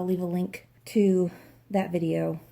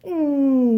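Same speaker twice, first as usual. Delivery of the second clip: long, loud "mmm" sliding down from high; doubtful "mmm"; 7 semitones higher, 8 dB louder than usual. Real, not acted. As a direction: draw out a high, falling hum of doubt. Doubtful verdict on looking back.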